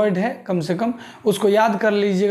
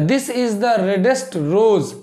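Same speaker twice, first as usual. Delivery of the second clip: very slow, word by word; dictating pace, each word apart; slow dictation; much slower than usual. Shown as a demonstration. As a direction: spell it out slowly for students. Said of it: "teacher dictating slowly".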